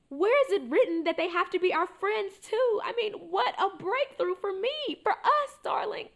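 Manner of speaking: high, loud, gushing excitement; elated mother. Acted. Mother gushes to daughter about support group, high and elated. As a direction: burst out high with a breakthrough. Mother thrilled by her breakthrough.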